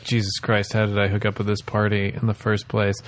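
low, quiet, fed-up aside; exasperated mutter. Retold or imitated. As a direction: grumble it under your breath. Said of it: "he's having a little bit of, like".

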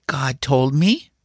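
put-on high, slow boy's assertion; brazening it out. A narrator's reading. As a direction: stretch out the bold claim like a child bluffing. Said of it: "brazening it out".

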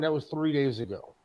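usual speaking voice

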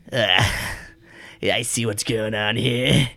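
put-on voice of a suited stranger; survey the scene in character, drawing out the opening; game master's shady newcomer voice; disguised businessman. Voicing disguised business-looking stranger.

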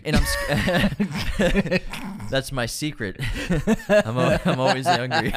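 giggling through the words; laughing while talking. Real, not acted. Laughing while quoting line.

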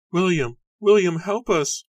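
high, loud cries for help; desperate cry. A narrator's reading. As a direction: cry out to your husband in panic. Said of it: "Martha cried".

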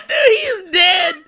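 high, slow words through laughter; laughing. Real, not acted. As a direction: gasp it out mid-laugh. Laughing at a knockout clip.

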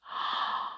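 quiet breathy gasp; soft astonished gasp. A narrator's reading. Narrator showing Flip's astonishment, soft gasp. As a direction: catch your breath in surprise.

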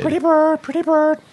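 high squawky parrot imitation. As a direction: chirp it like a pet parrot. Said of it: "I don't know why I did that".

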